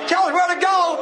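high, pleading demon holler; hollering devil. Retold or imitated. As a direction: holler up as a devil begging Jesus. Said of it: "the devil hollered to Jesus".

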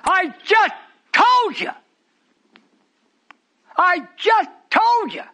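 mock exasperated call. Preacher voicing a weary answer, loud and slow. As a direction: hammer it home loud and slow.

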